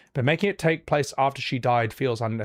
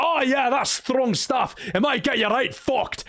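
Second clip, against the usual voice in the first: a jolly Scottish voice, lightly done; Scottish accent; 9 semitones higher than usual. Pretend. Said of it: "give it a Scottish accent or something".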